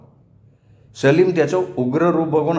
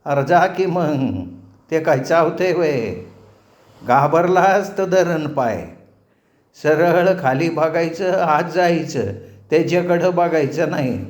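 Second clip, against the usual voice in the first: put-on old man, slow and higher, coaxing a timid boy; 5 semitones higher than usual. Old man urging nervous Salim past the dog, slow and coaxing. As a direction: talk like a kindly village elder urging him on.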